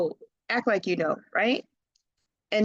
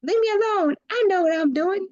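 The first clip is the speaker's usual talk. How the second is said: stubborn brush-off; 11 semitones higher, faster than usual. Retold or imitated. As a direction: brush off the advice like a cocky kid. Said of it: "And you're like".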